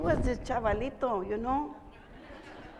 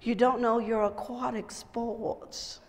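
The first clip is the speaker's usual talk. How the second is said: slow, drawn-out mock-pitying condescension; mock upper-crust drawl; snooty boarding-school boy; slower than usual. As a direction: imitate a condescending prep-school boy, lingering on words. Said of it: "a young man from a private boarding school".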